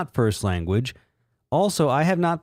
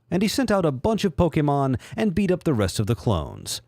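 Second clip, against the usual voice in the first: ordinary talk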